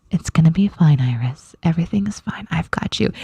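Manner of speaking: hushed, gentle whisper; soothing whisper. Retold or imitated. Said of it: "I'm saying this".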